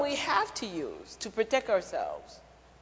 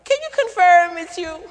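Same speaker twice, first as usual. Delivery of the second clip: higher, louder demand for proof; Peter demanding proof; 8 semitones higher, 7 dB louder than usual. Retold or imitated. Peter advocate demanding the figure confirm himself, louder and higher. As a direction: insist he proves who he is.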